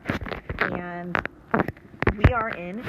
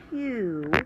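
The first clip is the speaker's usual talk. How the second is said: hu said alone, stressed; isolated stressed syllable. Shown as a demonstration. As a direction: pull out just hu. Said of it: "is the accented syllable".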